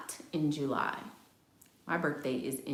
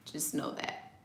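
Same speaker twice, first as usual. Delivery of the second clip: laughing whisper; 2 semitones lower, 11 dB quieter than usual. Shown as a demonstration. Warning viewers about a Cancer's revenge, whispered with a laugh. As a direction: whisper it again, softer, through a chuckle.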